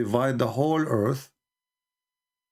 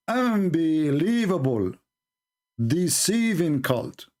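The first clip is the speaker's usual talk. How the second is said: slow scornful; much slower than usual. Real, not acted. Scornful verdict on opponents.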